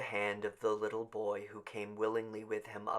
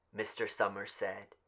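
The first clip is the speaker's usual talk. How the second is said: plain reading voice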